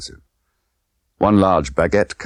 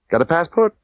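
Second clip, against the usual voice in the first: Tiffany voice; 12 semitones higher than usual. Acted. Tiffany questioning Bond.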